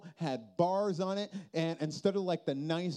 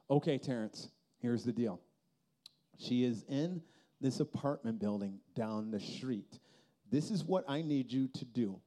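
lower, softer, grave instructions; serious friend on the phone; 7 semitones lower, 4 dB quieter than usual. Retold or imitated. Friend giving grim phone instructions.

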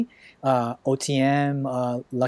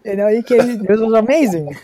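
higher, louder gushing; excited; 8 semitones higher, 7 dB louder than usual. Real, not acted. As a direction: rave about them, thrilled. Repeats it louder, more excited.

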